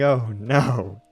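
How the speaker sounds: ordinary delivery, nothing marked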